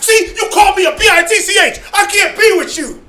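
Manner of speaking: mock indignant shout, laughing through it; mock outraged shout. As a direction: play the insulted brother making a scene. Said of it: "you're like".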